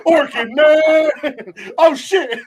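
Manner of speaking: high squealing comic alarm; loud, high-pitched hammed-up fright; mock panic. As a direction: cry out as a terrified little mosquito. Mosquitoes panic at the Orkin logo.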